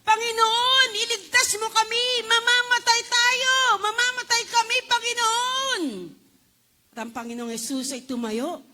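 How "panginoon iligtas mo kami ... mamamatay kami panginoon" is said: loud, high pleading cry; panicked shout of the disciples; desperate plea. Acted. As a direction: beg the Lord to save you. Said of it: "nang sabi nila".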